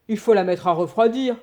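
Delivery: put-on old husband deciding, firmly; old man. A narrator's reading.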